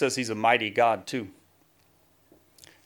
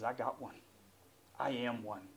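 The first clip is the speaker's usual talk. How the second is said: very soft, low answer; hushed Jesus quote; 3 semitones lower, 12 dB quieter than usual. Acted. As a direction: offer it quietly, low in the voice. Preacher voices Jesus answering softly.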